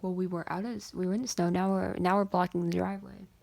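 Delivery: loud, insistent imitation of dad; exasperated dad. Dad complains about blocking the driveway, loud and exasperated. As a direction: complain loudly as the fed-up dad.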